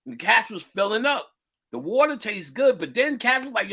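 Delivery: ordinary talk, nothing marked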